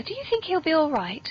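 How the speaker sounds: normal talking voice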